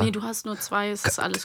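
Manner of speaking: soft, calm father reassuring his son; soothing father. Retold or imitated. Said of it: "Und dann sagt der Papa".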